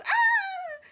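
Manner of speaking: short, very high mock shriek; imitated startled scream. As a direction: squeal as if startled, playfully. Acts Mulan's startled scream.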